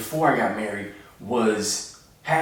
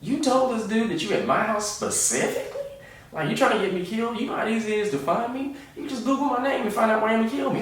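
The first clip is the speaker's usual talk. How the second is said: high panicked mock rant, faster at the end; 12 semitones higher than usual. Comedian panics that the caller now knows his address, high mock panic. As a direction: squeal it out like he fears for his life. Panicked reaction to being named.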